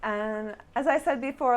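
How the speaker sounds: usual speaking voice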